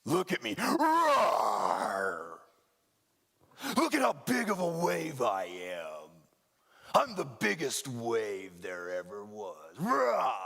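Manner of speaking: big boastful wave that roars and shouts; roaring wave. Pretend. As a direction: play a giant wave bragging, roaring at the boat. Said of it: "they are facing their".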